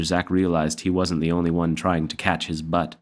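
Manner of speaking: plain reading voice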